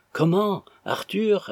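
high-pitched startled question; surprised. A narrator's reading. Madame Jérémie spots Arthur at the door, surprised. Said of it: "ajouta-t-elle d'un ton surpris".

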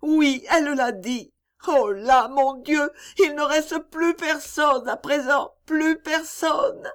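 put-on high child's lament, slowing at the end; a child left with nobody; forlorn little girl. Topsy's child voice, grieving.

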